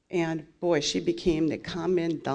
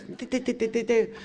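mocking inner chatter; 4 semitones higher than usual. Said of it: "my rational mind starts".

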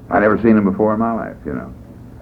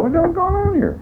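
very high, soft squeal; puzzled, squeaky thought; bewildered inner voice; 5 dB quieter than usual. Retold or imitated. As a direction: think it in a tiny baffled falsetto. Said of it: "I thought".

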